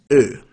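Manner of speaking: Ö said as a long rounded "euh"; German umlaut O. Shown as a demonstration. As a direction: hold a rounded Ö vowel. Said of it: "O with an umlaut is".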